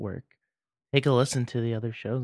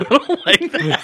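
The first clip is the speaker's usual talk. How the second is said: laughing through the words; 10 semitones higher, 6 dB louder, slower than usual. Real, not acted. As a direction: laugh while saying it. Laughing reply.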